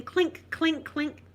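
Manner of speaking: bottle clinks. Retold or imitated.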